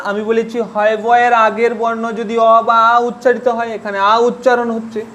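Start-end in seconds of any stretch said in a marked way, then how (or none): none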